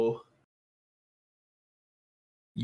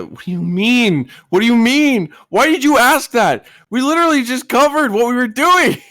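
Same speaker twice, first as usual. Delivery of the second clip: rising, louder mock outrage; mock exasperation; 12 semitones higher, 7 dB louder than usual. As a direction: play up the disbelief.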